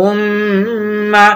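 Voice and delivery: উম্মা with the doubled ম held long and nasal; nasal ghunna. Shown as a demonstration. Teacher showing ghunna on উম্মা, drawn out. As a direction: hum the মম of উম্মা through the nose. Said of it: "নাকে আওয়াজ নিয়ে পড়বো".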